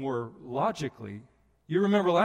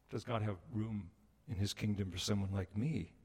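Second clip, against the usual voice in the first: hushed plea; 6 semitones lower, 8 dB quieter than usual. Real, not acted. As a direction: ask it quietly for yourself. Soft plea after high.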